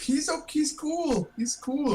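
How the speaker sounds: high, slow reassuring voice; high character voice. Acted. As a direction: pitch up and insist he's fine. Player character excusing a friend.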